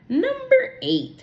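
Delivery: high, loud, slow announcement; announcer. Real, not acted. Host announcing the next question, game-show style.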